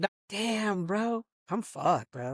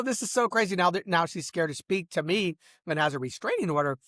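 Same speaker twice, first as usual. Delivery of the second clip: ordinary talk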